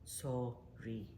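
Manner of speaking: Sorry said very quietly as a demonstration. Shown as a demonstration. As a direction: let Sorry fall away almost unheard. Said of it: "Practice it".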